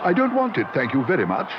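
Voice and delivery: high, flustered refusal; alarmed cry. A narrator's reading. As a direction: push it away in panic. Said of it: "he cried".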